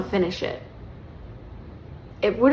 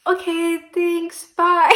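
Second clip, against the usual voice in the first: high, flustered goodbye, bye said louder; pitched up and fleeing the awkward moment; awkward quick goodbye; 10 semitones higher, 7 dB louder, much slower than usual. Retold or imitated. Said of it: "I was like".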